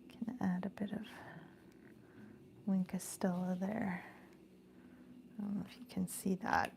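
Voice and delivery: soft unvoiced whisper. Real, not acted. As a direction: whisper it close to the mic. Aside while crafting on camera.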